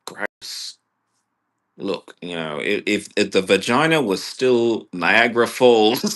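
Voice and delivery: ordinary talk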